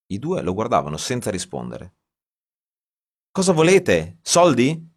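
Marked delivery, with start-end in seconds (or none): 3.3-4.9 loud, high accusing questions; angry demand. A narrator's reading. Writer accusing the two strangers of extortion, loud and high. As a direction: confront them, suspicious and loud.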